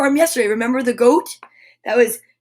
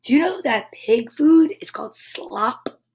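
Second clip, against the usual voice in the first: ordinary delivery, nothing marked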